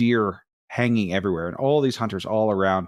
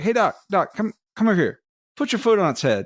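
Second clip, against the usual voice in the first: eager hunter; 9 semitones higher than usual. Retold or imitated. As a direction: pitch up and call him over like a pushy hunter. Hunter waving him over.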